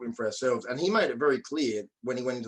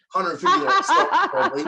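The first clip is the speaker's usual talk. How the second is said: laughing; 9 dB louder than usual. Real, not acted. Laughing at his own slip.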